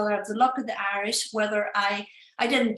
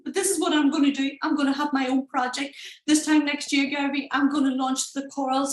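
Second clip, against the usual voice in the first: higher, rushing, emotional; live on stage, pitching herself higher and excited; nervous excited pitch; 6 semitones higher than usual. Retold or imitated. Nervous fan pitching her project to Gary, higher. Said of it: "I get on there and I'm like".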